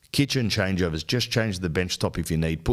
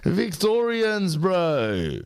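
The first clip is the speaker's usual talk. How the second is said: hyped announcer call; 13 semitones higher, much slower than usual. Acted.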